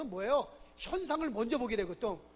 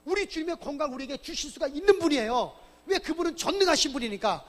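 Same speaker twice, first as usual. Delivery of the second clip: louder, building preacher's cry of faith; fervent preaching; 3 semitones higher, 6 dB louder than usual. Acted. Preacher to his congregation, proclaiming the Lord can heal, fervent rising preaching. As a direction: build to a ringing climax on God's power.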